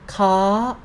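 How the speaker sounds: "ka" pitched high and rising. Shown as a demonstration. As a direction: lift "ka" gradually upward. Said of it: "represent the high sound".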